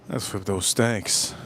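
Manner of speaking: low, slow, soft put-on voice; low mock voice. Streamer flips steaks on stream, low slow put-on voice. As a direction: croon over the steaks.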